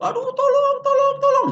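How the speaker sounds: very high, loud mock cry for help; screaming for help. Woman attacked at night, mock screaming for help. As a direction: shriek tolong like a woman in danger. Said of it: "Dia teriak-teriak".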